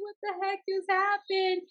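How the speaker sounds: high, bewildered question. Retold or imitated. To herself after the dance, high bewildered question. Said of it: "I'm like".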